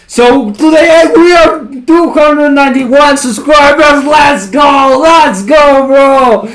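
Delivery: high, loud hyped-up shouting; gym bro. Real, not acted. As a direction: yell it like a pumped-up bro. Celebrating subscriber milestone.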